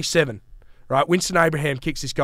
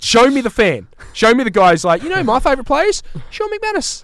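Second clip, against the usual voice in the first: louder, rising to a squeaky fan voice; indignant mock challenge; 9 semitones higher, 4 dB louder than usual. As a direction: demand to see this fan, then mock him high. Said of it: "I was like".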